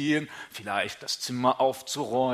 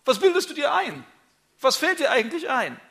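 much higher and louder, outraged; indignant rebuke; 13 semitones higher, 6 dB louder than usual. Acted. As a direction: put them in their place, pitch flying up.

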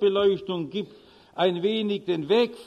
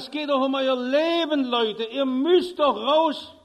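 higher, louder urgent plea, slowing at the end; louder pleading shout; 4 semitones higher than usual. Retold or imitated. Steward pleading with card players to leave, loud urgent shout. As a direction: beg them to save their lives. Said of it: "brüllt lauter".

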